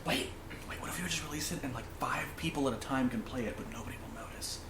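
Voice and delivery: fast hushed scheming whisper; conspiratorial whisper. Retold or imitated. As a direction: whisper the sneaky plan. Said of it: "they're like".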